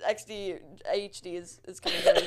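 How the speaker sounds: laughing through the words, rising; giggly, breaking up with laughter. Real, not acted. Laughing fit after choking.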